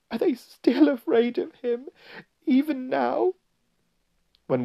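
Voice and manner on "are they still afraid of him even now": put-on high, tearful girl's question; acted sobbing in falsetto. A narrator's reading. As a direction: plead in distress, high throughout. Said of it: "sobbed Susan".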